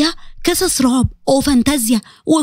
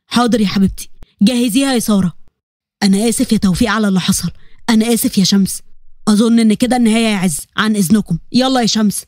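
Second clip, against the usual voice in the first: lower, steady men of the family; male relatives' voice; 6 semitones lower than usual. Acted. Family men apologising and leaving the hospital, lower and calm. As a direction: drop into a calm man's voice.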